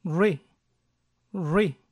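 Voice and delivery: "re" as r then a near-closed e, no u; r plus ɪ. Shown as a demonstration. Teacher shows r with the short vowel, careful. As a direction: join r straight to the vowel. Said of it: "Si solo pronuncio la R con la I mayúscula".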